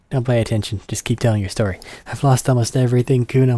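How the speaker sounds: usual speaking voice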